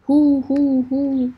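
loud, high hooting call to the dogs; shouted call. A narrator's reading. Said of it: "فریاد کشید".

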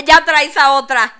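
bust him like a nosy uncle; mock uncle shout. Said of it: "Y te dijo". (tío burlón gritando)

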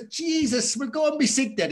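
high-pitched exasperated Irish father; Irish dad impression. Dad sends nervous son off before the bout, exasperated Irish accent. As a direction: dismiss the boy in an acted Irish accent. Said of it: "And my dad's Irish".

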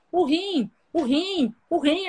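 a dad who can only say one word; imitating a frantic father; frantic repeating. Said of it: "falava assim pra minha mãe".

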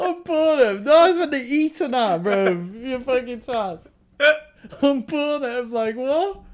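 high, loud, laughing through words; cracking up while mocking him. Real, not acted. Cracking up at friend's mishearing.

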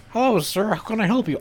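high, polite mock shop clerk; gun shop clerk. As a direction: chirp it like an overly eager salesman. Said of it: "the guy's like".

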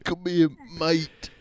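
high-pitched British caricature; mock British accent. Co-host echoing the bishop's call, mock British accent. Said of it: "make fun of British people".

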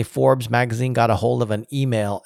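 ordinary talk, nothing marked

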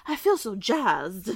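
slow, breathy glamour drawl; lightly voiced starlet; old Hollywood starlet. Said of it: "You sounded like an old Hollywood starlet".

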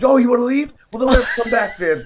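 hammed-up voice of an angry guard dog; dog's mock threat, pitched high and loud; voicing the dog. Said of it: "it was like".

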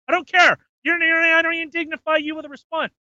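high, fast dismissive rant. Real, not acted. A host scoffs at the mayor in a high rant. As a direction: brush him off in a high voice.

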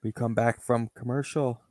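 ordinary talk, nothing marked

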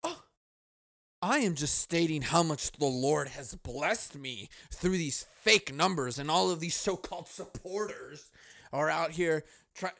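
mild mock indignation. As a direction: ham up a wounded self-important rant. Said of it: "He's like".

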